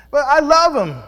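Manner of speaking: high, loud pleading "But I love him"; voicing a wife who won't leave. Voicing a spouse defending abuser.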